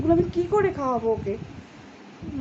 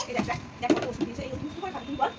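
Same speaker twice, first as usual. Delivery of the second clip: soft coaxing; 5 dB quieter, much faster than usual. Real, not acted. Softly showing child the medicines.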